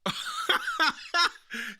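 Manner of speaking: a high, breathless burst of laughter. Real, not acted. Streamer cracking up at the clip, high burst of laughter. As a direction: let the laugh break up high.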